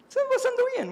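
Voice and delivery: mock high brain voice; voz aguda del cerebro. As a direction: squeak it out like the brain talking. (voz muy aguda y burlona)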